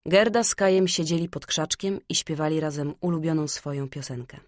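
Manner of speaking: plain reading voice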